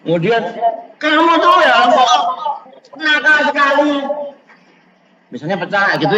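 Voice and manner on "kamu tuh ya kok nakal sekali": put-on high, loud shrill-free scolding of a naughty child; scolding mother. Mother scolds her child for breaking a glass, loud and high. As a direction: tell the kid off, loud and high.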